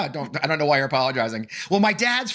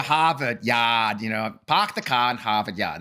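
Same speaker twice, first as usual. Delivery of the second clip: slow, r-less Boston "Harvard Yard"; Boston accent; 4 semitones lower, slower than usual. Shown as a demonstration. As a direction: drop every r, Boston style. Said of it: "Mass accent".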